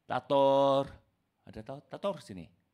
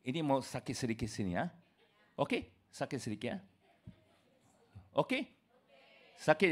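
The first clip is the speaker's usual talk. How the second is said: quiet aside; 5 semitones lower, 9 dB quieter, faster than usual. Real, not acted. Aside about pain.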